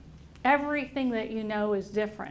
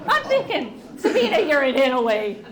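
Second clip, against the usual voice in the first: laughing; 8 dB louder than usual. Retold or imitated. Thinking about the confused sympathy call.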